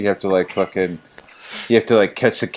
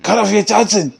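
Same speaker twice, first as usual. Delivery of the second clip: put-on German accent; 11 semitones higher than usual. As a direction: bark it like a movie German thug. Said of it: "that's The Big Lebowski".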